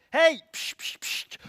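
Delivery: loud high hey, then hissed pssts; urgent crowd hush; shushing. Acted. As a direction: silence someone sharply. Crowd's first reaction, hushing him.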